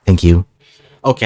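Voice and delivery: normal talking voice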